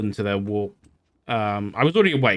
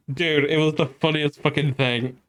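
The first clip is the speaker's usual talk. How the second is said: normal talking voice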